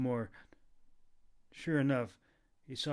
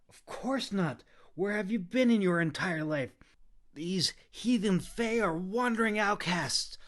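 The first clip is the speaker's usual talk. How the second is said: scornful; 8 semitones higher, 6 dB louder than usual. A narrator's reading. Scornful after eye roll.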